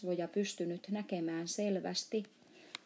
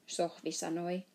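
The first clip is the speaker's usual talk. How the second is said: ordinary delivery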